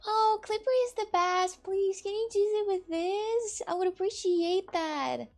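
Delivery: high, loud mimicking voice; Paulina. Streamer mimics friend begging for a clipper, slow high mock plea. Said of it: "because she says that".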